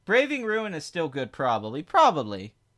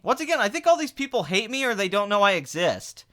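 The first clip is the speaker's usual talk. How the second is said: ordinary delivery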